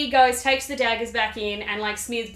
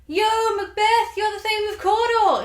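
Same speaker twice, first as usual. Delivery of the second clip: witches hailing Macbeth; 10 semitones higher, 5 dB louder than usual. Acted. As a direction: holler it out like a rowdy prophecy. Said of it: "And the witches are like".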